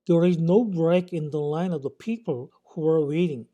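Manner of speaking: very slow, careful English sentence; slow example reading. Shown as a demonstration. English teacher reading an example sentence slowly to students. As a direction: read each English word slowly for learners.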